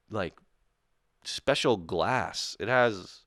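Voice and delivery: ordinary talk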